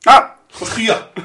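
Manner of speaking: sudden loud high yelp of shock; startled cry. Real, not acted. Co-host asks a cheeky question, startled loud yelp. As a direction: caught off guard, blurt it out.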